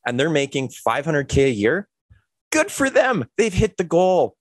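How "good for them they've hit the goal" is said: mock cheer. Mock praise of overpaid worker.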